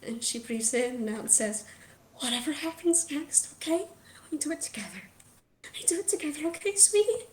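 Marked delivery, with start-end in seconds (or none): 2.2-7.3 high, fragile mother's plea; tearful reassurance. Acted. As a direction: dip into a whisper, then lift tenderly. Weepy mother reassures her son.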